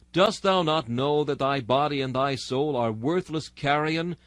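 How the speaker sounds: normal talking voice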